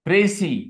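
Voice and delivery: précis said as "pray-see", stress on the first part; teacher modeling précis with a falling tune; model pronunciation. Shown as a demonstration. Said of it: "it is pronounced as".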